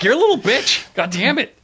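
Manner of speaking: high, laughing mock outburst; a hammed-up Buck snapping, laughing; laughing mock curse. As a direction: curse through laughter, hamming it up.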